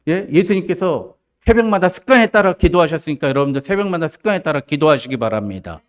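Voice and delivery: high, loud pulpit proclamation; impassioned preaching. Real, not acted. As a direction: drive it home to the whole congregation. Preaches at full force.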